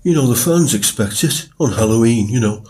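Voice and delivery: chirpy and chummy, as if giving a thumbs up. Retold or imitated. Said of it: "imagine me giving a [name] style thumbs up".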